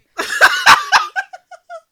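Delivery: shrieking burst of laughter; a sudden loud high laugh. Real, not acted. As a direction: let out a big high cackle. Big laugh at her own comeback.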